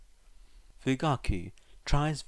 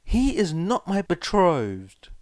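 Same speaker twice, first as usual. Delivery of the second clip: insistent protest; 8 semitones higher, 8 dB louder, slower than usual. Acted. Boy insists, embarrassed.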